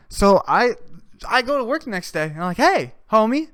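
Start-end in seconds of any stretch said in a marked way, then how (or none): 2.6-3.5 loud, high disbelieving "what the fuck"; incredulous confrontation. Retold or imitated. Confronting the coworker at work the next day, loud and incredulous. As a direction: call out your buddy, half laughing in disbelief.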